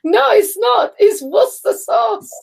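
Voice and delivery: playful insistence on Worcester; emphatic insistence. Real, not acted. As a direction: declare it's "wooster sauce" outright.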